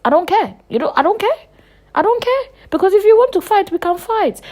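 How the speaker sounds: rising from loud to very high and loud; furious, escalating fighting talk; heated outburst. Real, not acted. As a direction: build it into a shrill fighting rant. Working herself up about fighting.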